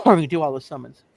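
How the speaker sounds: ordinary talk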